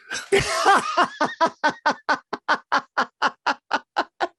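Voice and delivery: long high rising laugh; delighted laugh. Real, not acted. Laughing at his own joke to the host, real laughter. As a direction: burst out laughing at the punchline.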